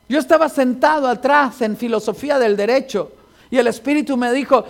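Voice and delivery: usual speaking voice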